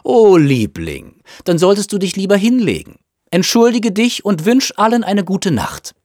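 high, cooing concern; fussing mother. A narrator's reading. Mother sending her sick son to bed, fussing. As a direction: coo in a high voice, then give instructions.

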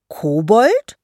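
"Kobold" as a loud, rising question; high, affronted "Kobold?"; indignant echo. Acted. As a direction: repeat "Kobold" in offended disbelief, pitch climbing. Troll repeats the insult, offended.

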